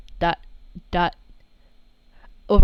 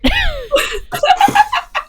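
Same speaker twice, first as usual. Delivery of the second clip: squeaky laugh, much higher; high character laugh; 6 dB louder than usual. Acted. Sammy laughs at Arata's claim, a high giggle.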